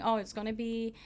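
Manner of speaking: ordinary talk, nothing marked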